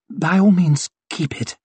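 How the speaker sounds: lightly voiced lady with a touch of hoarseness; slightly hoarse murmur. A narrator's reading. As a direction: let it trail down into a husky murmur. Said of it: "murmured Lady Wetterland in a voice slightly hoarse".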